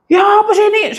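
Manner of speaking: high, loud exasperated yell; angry outburst. Retold or imitated. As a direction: blow up at the other driver. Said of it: "Saya marah-marah begitu".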